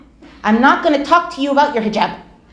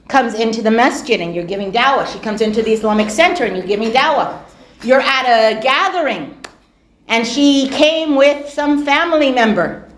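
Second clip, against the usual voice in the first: normal talking voice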